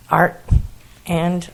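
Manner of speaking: usual speaking voice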